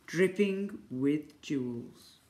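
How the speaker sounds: slow, drawn-out description; savoured slowly. A narrator's reading. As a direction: stretch out each word of the riches. Narrator relishes the lady's riches.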